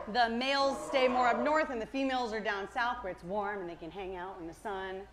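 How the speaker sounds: normal talking voice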